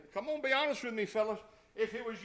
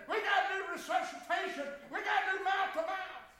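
panicked screaming; 10 semitones higher, 8 dB louder than usual. Pretend. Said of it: "you know what we'd have done?".

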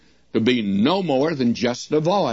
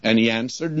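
ordinary talk

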